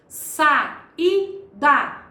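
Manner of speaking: Sa, i, da split apart, rising toward i; syllable by syllable. Shown as a demonstration. Teacher splitting saída into syllables to show a hiatus. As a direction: separate each syllable clearly.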